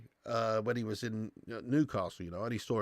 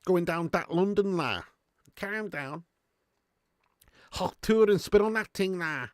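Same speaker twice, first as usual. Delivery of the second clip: higher, hammed-up Scouse accent; Harry Enfield Scouser; 4 semitones higher than usual. Streamer quoting the Harry Enfield sketch in a mock Scouse accent. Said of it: "Love Harry Enfield and Chums".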